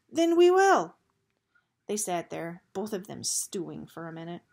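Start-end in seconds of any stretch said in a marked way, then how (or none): 0.1-1.0 much higher, much louder shout; defiant shout. Acted. Gregor shouting back at Eris in the cell, defiant. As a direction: throw it back at him.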